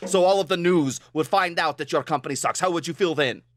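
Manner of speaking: dramatic Russian caller's rant; imitated indignant Russian customer; thick Russian accent. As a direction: threaten theatrically in a hammed-up Russian accent. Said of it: "in a super thick Russian accent".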